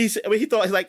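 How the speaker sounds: ordinary talk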